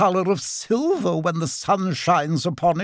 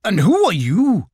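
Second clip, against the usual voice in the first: mildly affronted, higher-pitched retort; offended rabbit; 7 semitones higher, 4 dB louder than usual. A narrator's reading. Rabbit asking the rude otter back, mildly offended. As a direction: throw the question back, a touch indignant. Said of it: "startled and rather offended".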